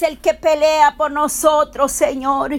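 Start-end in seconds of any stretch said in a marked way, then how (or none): none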